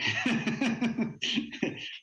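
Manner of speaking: short amused laugh; light laugh. Real, not acted. Laugh after the joke.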